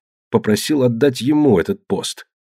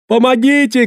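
very high, sustained scream for help; panicked high-pitched yelling; frantic cry; 3 dB louder than usual. A narrator's reading. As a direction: shriek for rescue on one high pitch. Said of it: "кричал парень".

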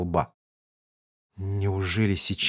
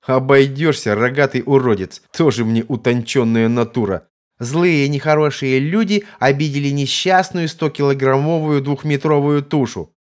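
loud, higher mocking taunt; jeering guard; 5 semitones higher, 5 dB louder than usual. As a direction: taunt him loudly from above.

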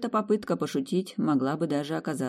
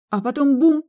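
leaps high and loud on bum; sudden boom; 12 semitones higher, 7 dB louder than usual. Acted. Woman telling of the wedding, a dramatic boom. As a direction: toss out the bang dramatically.

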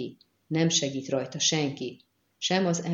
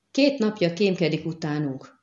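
plain reading voice

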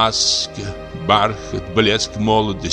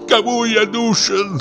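slow, very high, anguished question; drawn-out cry of abandonment; despairing wail. Acted. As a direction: wail like a forsaken old man. Lonely actor cries out in despair.

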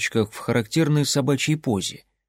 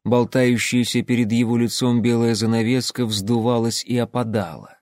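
ordinary delivery

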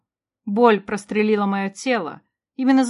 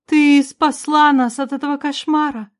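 ordinary delivery, nothing marked

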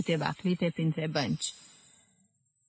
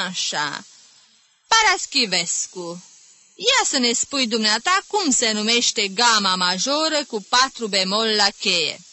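raised, mildly mocking teacher; lightly voiced stern music teacher; 5 semitones higher, 4 dB louder than usual. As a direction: quiz the pupil with sly menace. Teacher questioning pupil. (profesor sever, ușor batjocoritor)

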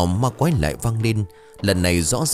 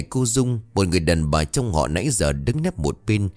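ordinary delivery

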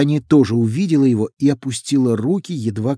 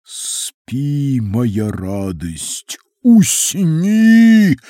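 basso profundo lullaby; 4 dB louder, much slower than usual. A narrator's reading. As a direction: sing it slow in a booming bass. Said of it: "натуральный бас-профундо".

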